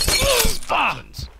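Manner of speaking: a high, roared call to the troops; King Leonidas war cry. Retold or imitated. Rallying his Spartans, a high roared war cry. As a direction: roar it to the army. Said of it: "In the words of King Leonidas".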